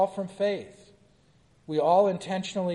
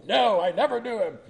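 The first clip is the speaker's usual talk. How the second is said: a touch higher, defensive denial; Peter's denial; 5 semitones higher, 5 dB louder than usual. Acted. Peter denying Jesus to the girl, mild defensive voice. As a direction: have Peter brush her off quickly.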